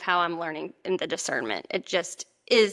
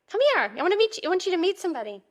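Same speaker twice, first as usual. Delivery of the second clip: high, cooing voice for a pet; 10 semitones higher, 3 dB louder, much faster than usual. Real, not acted. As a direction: call the dog sweetly and high. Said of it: "you just heard my dog baby voice".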